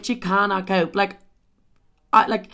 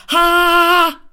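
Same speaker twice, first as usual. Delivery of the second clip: loud, higher mock laugh; 11 semitones higher, 10 dB louder than usual. Mocking the ex's texted laugh, a loud fake ha. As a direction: throw his fake laugh back at him.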